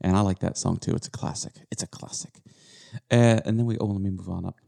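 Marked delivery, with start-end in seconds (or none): 0.9-1.5 whispering. Real, not acted. Whispered aside. 1.7-2.3 whispering. Real, not acted. Repeated in whisper.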